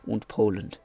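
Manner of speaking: put-on muddled French-German accent; mock French narration drifting toward a German accent; French accent slipping into German. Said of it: "I'm going German, I don't know what the hell is this accent".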